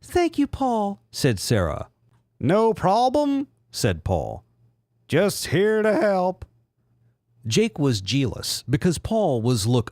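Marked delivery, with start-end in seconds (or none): 0.0-1.0 very high falsetto gratitude; squeaky, sugary thanks; Sarah voice. Acted. 2.4-6.4 high, slow smug falsetto; cool-guy line in a silly squeak; Paul voice. Acted.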